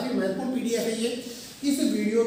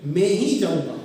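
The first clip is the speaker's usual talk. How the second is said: ही stretched and punched, then falling; slow, emphatic ही in the middle; stress on ही; 6 dB louder than usual. Shown as a demonstration. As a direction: lean hard and long on ही. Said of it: "इसके अंदर गौर किया गया".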